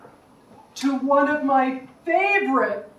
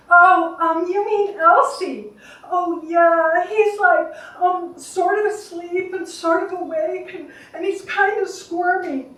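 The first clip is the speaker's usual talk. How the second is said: higher-pitched, hesitant caregiver on the phone; Rachel; 4 semitones higher, 3 dB louder than usual. Acted. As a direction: play an unsure friend describing the patient, voice raised. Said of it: "Is this Rachel?".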